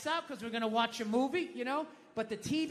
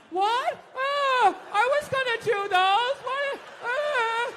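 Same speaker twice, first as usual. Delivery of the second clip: high, hammed-up fake surprise; mock innocent partner; 12 semitones higher, 9 dB louder, much slower than usual. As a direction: play the caught-out partner, high and loud. Partner acting surprised at dishes.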